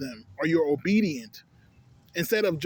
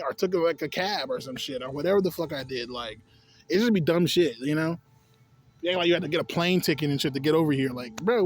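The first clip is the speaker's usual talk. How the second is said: ordinary talk, nothing marked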